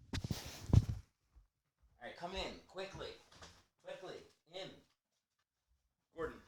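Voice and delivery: called from across the room, away from the mic; coaxing the dog. Real, not acted. Owner calling his old dog in from the door, coaxing, off mic. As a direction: coax the dog in from a distance.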